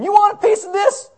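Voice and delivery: loud, high mock fighter taunt with a laugh; tough Jesus challenge. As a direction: dare them to step up, laughing. Jesus voiced flipping tables, laughing.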